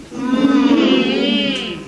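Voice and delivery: Mm hummed with lips closed; m sound. Shown as a demonstration. Teacher demonstrating the m sound with lips closed. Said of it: "make the sound again".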